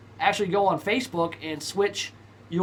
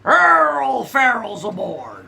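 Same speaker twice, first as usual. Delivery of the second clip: slow drawn-out pirate call; 5 semitones higher, 6 dB louder, much slower than usual. Pretend. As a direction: hail the crew like a pirate. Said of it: "talk like a pirate".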